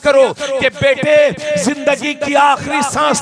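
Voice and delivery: fiery preaching. Acted. Preacher urging the congregation to keep faith, shouting. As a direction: build to a full-throated sermon climax.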